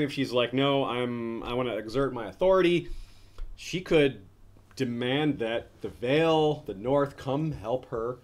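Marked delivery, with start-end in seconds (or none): none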